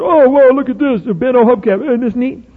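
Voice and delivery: put-on high, wide-eyed little kid marveling at junk; preacher imitating a kid at the dump. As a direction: play the kid thrilled by a bent hubcap.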